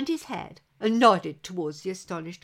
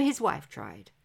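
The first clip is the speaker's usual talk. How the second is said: ordinary delivery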